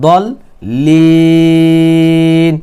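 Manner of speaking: short "দল", then a very long held "লিন"; stop-ending elongation. Shown as a demonstration. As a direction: hold "লিন" on one even note before stopping. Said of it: "এখানে অক্ফ করে দেই".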